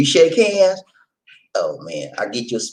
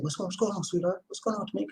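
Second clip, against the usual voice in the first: soft fatherly reply; 6 semitones higher, 13 dB quieter than usual. Retold or imitated. Father answers daughter's call.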